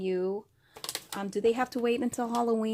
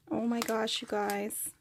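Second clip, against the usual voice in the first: ordinary talk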